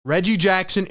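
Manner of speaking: normal talking voice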